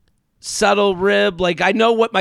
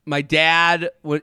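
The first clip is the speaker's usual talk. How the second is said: ordinary talk, nothing marked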